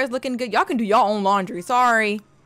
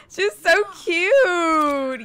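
tearful; 7 semitones higher, much slower than usual. Real, not acted. Crying with joy.

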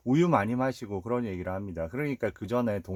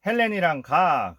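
insistent; 7 semitones higher, 7 dB louder than usual. Retold or imitated. Older brother insisting firmly.

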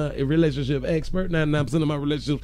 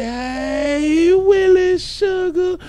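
high, loud, slow falsetto call; loud falsetto greeting; 12 semitones higher, 7 dB louder, much slower than usual. Acted. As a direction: call it out up high like a pet name. Reading out a viewer's greeting.

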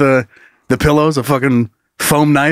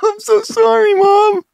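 high squeaky voice broken by laughter; 1 laughing apology; slower than usual. Acted. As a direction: laugh through a mock apology to Mom.

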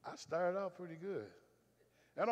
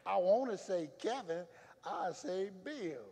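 laughing; 5 semitones higher, 3 dB louder, slower than usual. Real, not acted.

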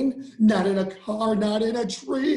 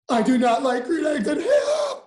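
laughing shout; 8 semitones higher than usual. Acted. Character bursts out, laughing shout.

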